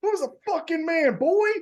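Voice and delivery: mock-tough kid boasting after a lucky punch; cocky kid taunt. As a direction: crow at the room like a tough little kid. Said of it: "I was like".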